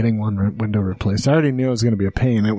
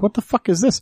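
higher-pitched mock bafflement; retold disbelief; 8 semitones higher than usual. Homeowner spotting broken glass on the porch, retold disbelief. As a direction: re-enact a baffled reaction.

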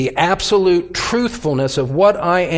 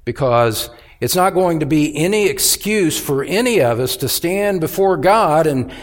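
ordinary delivery

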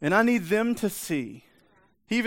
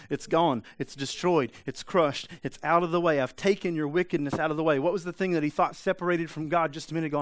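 ordinary delivery

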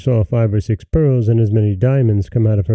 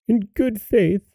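crying out; 11 semitones higher, 4 dB quieter than usual. A narrator's reading. Mother exclaiming at the diamonds, crying out softly. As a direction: exclaim in a raised character voice. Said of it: "cried the mother".

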